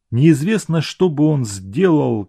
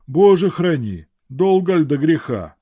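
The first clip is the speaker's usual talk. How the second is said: yawning; 5 semitones higher than usual. A narrator's reading. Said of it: "промолвил сторож, зевая".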